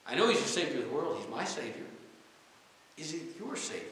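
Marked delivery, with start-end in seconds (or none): none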